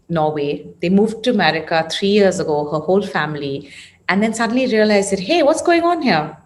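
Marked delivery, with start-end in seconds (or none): 5.2-6.4 higher-pitched mock surprise; retold puzzled surprise. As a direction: lightly act out a sudden realization. Acted quote of a sudden realization.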